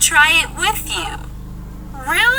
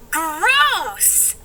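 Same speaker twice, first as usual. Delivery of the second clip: ordinary talk, nothing marked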